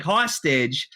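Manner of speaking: ordinary delivery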